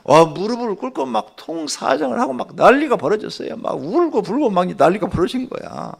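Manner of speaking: high, loud, agitated retelling; breathless preacher's excitement; worked-up storytelling. A narrator's reading. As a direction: tell of the uproar with mounting fervor. Excitedly narrating frantic begging.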